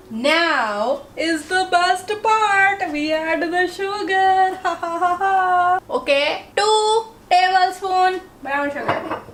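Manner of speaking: giddy cooking host adding the sugar; excited. Real, not acted. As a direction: savour it like the best moment of the day. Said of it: "Sugar just makes me so excited".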